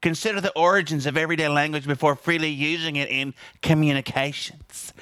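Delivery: a hint of a sanctimonious bureaucrat; mocking prissy voice. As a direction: lightly mimic a prim university official. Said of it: "that's how they said it, just like that".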